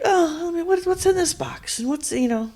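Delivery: pitched well up, soft and curious; curious quote. Retold or imitated. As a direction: wonder aloud at a box you found. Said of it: "and I was like".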